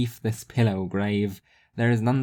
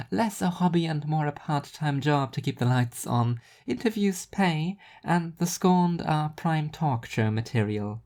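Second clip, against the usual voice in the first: higher, dry self-mocking voice; Rhea, wry and higher; 5 semitones higher than usual. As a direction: shrug off the hate with dry humour. Rhea answers Seth.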